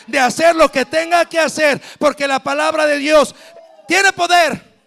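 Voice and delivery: loud, building proclamation; shouted climax. Acted. Clímax de la prédica. (clímax gritado de la prédica)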